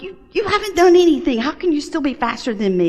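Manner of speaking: a competitive wife, fed up; hammed-up frustration at the husband; mock angry complaint. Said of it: "it would make me so angry".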